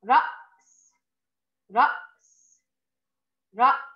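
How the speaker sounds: رأس three times with a clear hamza; model reading. Shown as a demonstration. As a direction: read رأس slowly for the class. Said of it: "الكلمة الثالثة". (نطق رأس ثلاث مرات)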